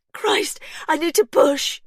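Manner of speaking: high, loud and slow, straining; woman in labour crying out, loud and strained. A narrator's reading. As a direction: cry it out mid-contraction. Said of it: "Pippa cried".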